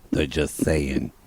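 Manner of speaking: ordinary talk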